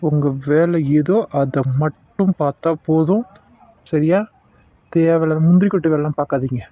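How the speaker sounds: low, cold warning to a meddler; menacing villain. Acted. Villain warns the doctor to mind his own business, low and menacing. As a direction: lean in low and threaten him.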